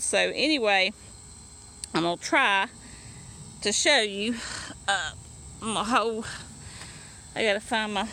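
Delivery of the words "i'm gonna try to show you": ordinary talk, nothing marked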